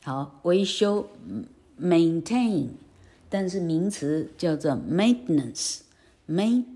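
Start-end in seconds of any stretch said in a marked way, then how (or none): none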